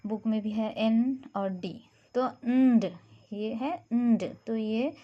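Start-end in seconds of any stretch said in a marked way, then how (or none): 2.1-2.9 "nd" sounded louder, blended n and d; teacher showing an ending blend. Shown as a demonstration. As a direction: stress the blend "nd". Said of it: "ये भी एक तरह का blending है".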